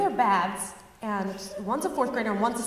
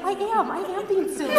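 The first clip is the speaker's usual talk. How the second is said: put-on meek agreeing babysitter; 9 semitones higher, 3 dB louder than usual. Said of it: "I would be like".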